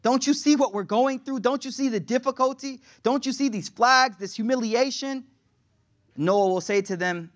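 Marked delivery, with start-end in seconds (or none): 0.0-5.2 urgent, high-pitched entreaty; pleading. Acted. Desperate people begging a prophet to intercede, pleading. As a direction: beg for help, voice pitched up.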